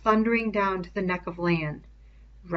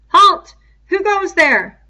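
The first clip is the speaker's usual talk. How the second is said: much higher and louder, ringing; sharp sentry challenge; 12 semitones higher, 14 dB louder, slower than usual. A narrator's reading. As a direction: call sharply down from the rocks. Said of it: "The voice rang out sharply from on high".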